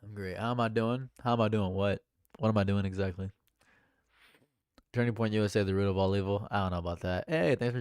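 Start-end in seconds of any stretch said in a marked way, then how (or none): none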